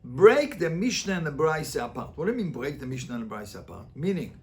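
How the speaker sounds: ordinary talk, nothing marked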